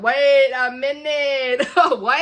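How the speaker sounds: loud, slow, disbelieving with a laugh; shocked disbelief. Retold or imitated. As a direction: drag out the shock then laugh. Retelling her shocked reaction.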